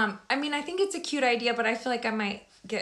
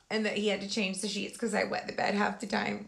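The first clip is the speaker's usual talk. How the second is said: normal talking voice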